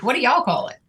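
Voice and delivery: ordinary talk, nothing marked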